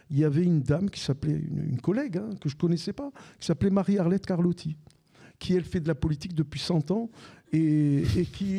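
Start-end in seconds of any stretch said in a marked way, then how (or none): none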